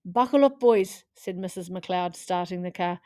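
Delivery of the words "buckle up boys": higher, louder "buckle up"; Scottish mum telling the boys to buckle up; brisk call. Acted. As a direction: call the boys to order in the car. Mum ordering boys into car.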